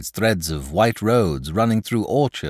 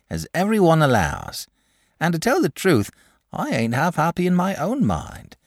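higher, lilting rustic mother; sensible country mother; 5 semitones higher than usual. Acted. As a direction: speak up kindly as a country mother. Mother speaks up for boy.

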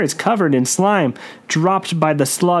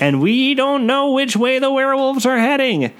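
put-on high nervous kid voice; panicky Todd; 8 semitones higher than usual. A narrator's reading. Todd frets to his friend in a high, nervous voice.

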